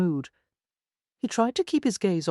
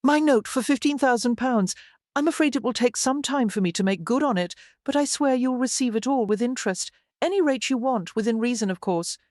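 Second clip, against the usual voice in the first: put-on a higher, fast, nervous young woman pleading her case; higher-voiced Lily, fast and anxious; Lily, anxious and rushing; 6 semitones higher than usual. A narrator's reading. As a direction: rush the promise out, eager to settle the debt.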